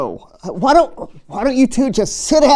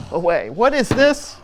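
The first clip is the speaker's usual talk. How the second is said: usual speaking voice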